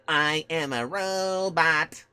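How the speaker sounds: slow, lightly voiced mock robot. Joking with his gaming friends in a slow robot voice. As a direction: drone it out slowly like a robot. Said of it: "hearing me say".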